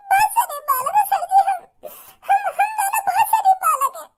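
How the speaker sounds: high, wailing fake sobs; hammed-up crying. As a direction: blubber your innocence to the officer. Boy fakes tears to officer.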